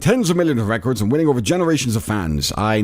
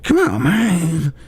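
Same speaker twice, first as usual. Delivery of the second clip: put-on high, breathy old-man Biden voice; mock Biden, pitched way up; hammed-up Biden "come on, man"; Biden impression; 11 semitones higher, much slower than usual. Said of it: "Biden says".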